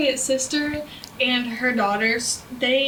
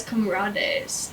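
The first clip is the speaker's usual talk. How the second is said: comrades said as kom-RAH-days in a light Mexican accent; 4 semitones lower, 3 dB quieter than usual. Shown as a demonstration. Student misreading comrades aloud in class, light Mexican accent. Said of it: "in, like, a Mexican accent".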